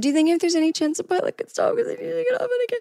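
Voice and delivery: polite ask breaking into a high, fast cry; crying plea. Retold or imitated. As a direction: plead politely until the voice cracks into tears. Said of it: "And I asked politely".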